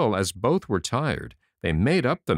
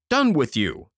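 cocky tailor; 6 semitones higher, 4 dB louder than usual. A narrator's reading. Tailor accepts king's offer.

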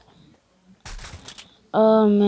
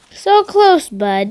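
excited; 7 semitones higher, 8 dB louder than usual. Real, not acted. Near miss in game.

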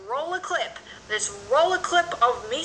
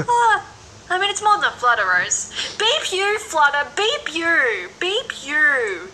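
hammed-up yelling "Beep you", louder and higher; mock outrage; 4 semitones higher, 5 dB louder, slower than usual. Teasing a friend, playfully shouting "Beep you" at Flutter. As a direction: jokingly curse out a fellow actor. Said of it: "I'm kidding".

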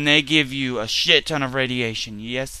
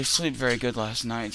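ordinary delivery

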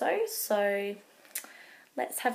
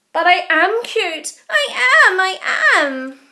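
higher, louder insistence; insisting; 8 semitones higher, 11 dB louder than usual. Acted. Baby Owl insisting to Mum, higher and louder. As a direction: insist like a cross toddler.

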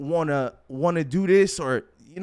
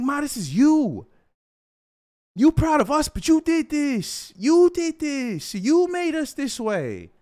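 emphatic insistence; 8 semitones higher than usual. Retold or imitated. Son telling Mom she made her kids, high emphatic insistence. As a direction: hand the credit back, stretching it out high.

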